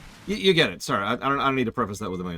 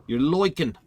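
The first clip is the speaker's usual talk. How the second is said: loud, higher "loiking" with a New York twang; silly New York accent; 7 semitones higher, 8 dB louder than usual. Pretend. Gamer streaming, repeating the word in a loud mock New York accent. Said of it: "I don't know why I said that with an accent".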